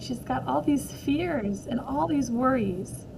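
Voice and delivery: ordinary delivery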